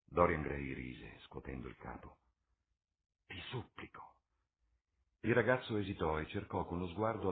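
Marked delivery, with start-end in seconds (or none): 3.3-4.2 quiet, understated plea; soft pleading. Acted. Basil begs Dorian not to go, pleading softly. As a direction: beg him softly.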